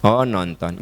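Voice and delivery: Oh, nonton said evenly and accepting; calm acceptance. Acted. A mother accepts her child's honest answer, calmly. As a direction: take the news calmly, without sulking. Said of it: "Ibu teh jangan ngambek".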